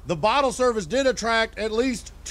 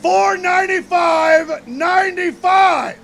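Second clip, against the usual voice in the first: much higher, louder, slower shocked yell; outraged shout; 7 semitones higher, 6 dB louder, slower than usual. Retold or imitated. Comic shouting the bottle price back at the crowd. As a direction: bellow the price back in disbelief.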